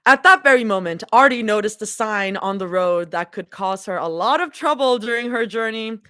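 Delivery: ordinary delivery, nothing marked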